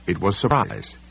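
ordinary delivery

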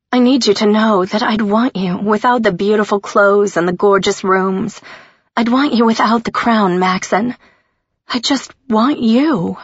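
thick-voiced, near crying; emotional, holding back tears; close to tears. A narrator's reading. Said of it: "Her voice was getting thick".

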